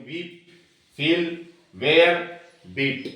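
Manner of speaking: वीप, फील, वेयर, बीट, each in a single push; one syllable each. Shown as a demonstration. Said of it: "एक ही झटके में बोल रही है।". (वीप, फील, वेयर, बीट हर शब्द एक झटके में)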